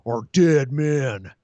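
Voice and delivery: very slow menacing threat; slow villain threat into the lens. Retold or imitated. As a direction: drag out each word to the camera. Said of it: "Look in the camera and go".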